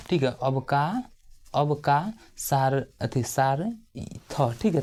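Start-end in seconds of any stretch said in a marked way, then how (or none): 1.5-4.5 drawn-out अवकाशार-, very slow; slow dictation. Shown as a demonstration. Teacher building the Sanskrit word for students, slowly. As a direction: sound out अवकाशार- piece by piece. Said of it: "teacher spells word for students".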